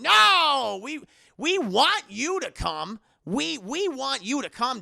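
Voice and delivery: high, loud, slow insistent reassuring; overdone reassurance. Retold or imitated. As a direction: protest too much, slowly. Friends insist to Danny.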